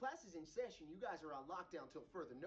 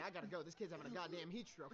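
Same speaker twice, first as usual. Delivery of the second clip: usual speaking voice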